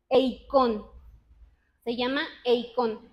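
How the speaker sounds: eikón said as ei-KON, stress on the last syllable; Greek word eikón. Shown as a demonstration. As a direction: pronounce eikón slowly and clearly as ei-kon. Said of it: "que se dice". (eikón pronunciado ei-KON)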